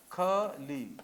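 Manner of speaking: खली split in two with a pause; syllable by syllable. Shown as a demonstration. Spelling out a name.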